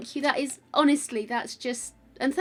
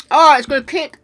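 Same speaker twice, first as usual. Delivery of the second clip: loud, higher yelps of reaction; sour-shock outburst; 5 semitones higher, 18 dB louder than usual. Real, not acted. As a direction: cry out as the sourness hits. Reacts to sour candy kicking in.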